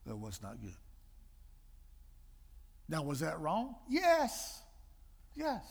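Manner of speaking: usual speaking voice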